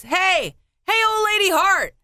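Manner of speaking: heckler calling out. Acted. Onlooker hailing a coworker's spouse in the parade, teasing call. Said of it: "I heard this".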